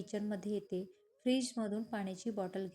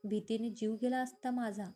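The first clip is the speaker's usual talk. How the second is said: plain reading voice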